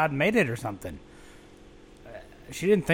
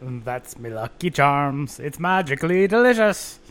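Irish accent; 4 dB louder than usual. Shown as a demonstration. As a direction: sing it like the Lucky Charms leprechaun. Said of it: "Say it like that for the audience".

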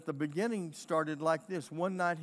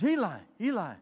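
higher, louder call to a sleeper; calling out to wake someone; 4 semitones higher, 8 dB louder than usual. Acted. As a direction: call out to rouse the sleeping priest. Boy calls to sleeping priest.

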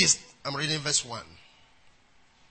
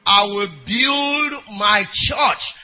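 emphatic shout; much slower than usual. Acted. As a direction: drag out build my church like a battle cry. Climax of the quoted promise.

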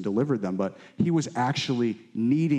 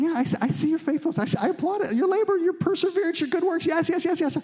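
high, tender, approving voice as Jesus; Jesus speaking. Retold or imitated. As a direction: lift the voice high and affirming like Jesus praising the church. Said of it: "He's saying".

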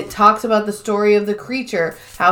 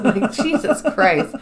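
laughing; 4 semitones higher than usual. Real, not acted. Laughing at the joke.

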